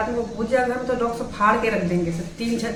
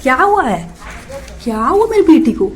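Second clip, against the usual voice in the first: loud, high sing-song pet talk; cooing to a pet; 4 semitones higher, 8 dB louder than usual. Real, not acted. Owner fusses over her parrot, loud high cooing.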